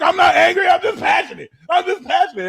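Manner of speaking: high, loud insisting; hammed-up angry denial, pitched high; fire sign turning up. Fire sign turning up on you, loud and high. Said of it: "turn up on you and be like".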